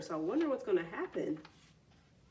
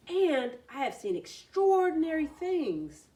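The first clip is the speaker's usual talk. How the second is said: excited; 7 semitones higher, 5 dB louder than usual. A narrator's reading. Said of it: "said the frog".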